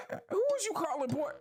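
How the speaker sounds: high mocking challenge. Slaver challenges Schultz.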